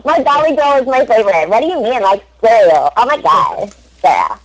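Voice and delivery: put-on showy American girl accent. Shown as a demonstration. Performer showing off after a compliment, American accent. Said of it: "that was an amazing American accent".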